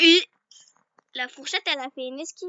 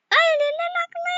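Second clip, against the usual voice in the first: much higher, a delighted squeal of discovery; surprised find; 5 dB louder, much faster than usual. Real, not acted. Player spotting the key, high excited squeal. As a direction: she suddenly spots the key.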